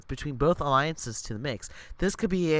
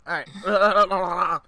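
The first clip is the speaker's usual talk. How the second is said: higher, louder put-on voice; put-on character voice; 4 semitones higher, 7 dB louder than usual. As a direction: try out a character voice. Trying out a new character voice.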